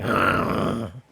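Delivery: imitated raspy groan of a ruined voice; horrible tuneless croak. Coach mimics someone who never sang a tune, horrible croak. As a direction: croak out one long rough noise. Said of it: "And they sound like".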